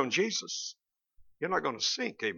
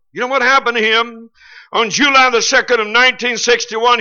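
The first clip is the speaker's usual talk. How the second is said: louder, driving preacher's build to the point; fervent preaching; 5 semitones higher, 8 dB louder than usual. Real, not acted. Preacher warning the congregation about Hemingway's end, fervent preaching.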